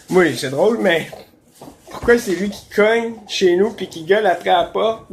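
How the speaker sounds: normal talking voice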